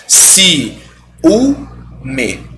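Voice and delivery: Si, u, me said as three separate syllables; syllables split apart. Shown as a demonstration. Teacher separates ciúme into syllables for students. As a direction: break ciúme into Si, u, me. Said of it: "vai ser hiato".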